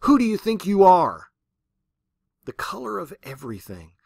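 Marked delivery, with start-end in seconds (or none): 0.0-1.3 loud, higher furious roar. Retold or imitated. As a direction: bellow it at heaven. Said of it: "and she roared".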